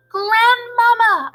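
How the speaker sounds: loud long high call through a door; shouting. A narrator's reading. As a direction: call out to Grandmama as loud as possible. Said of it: "I shouted as loudly as I possibly could".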